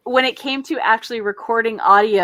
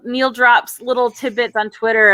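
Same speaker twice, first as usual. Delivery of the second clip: ordinary talk, nothing marked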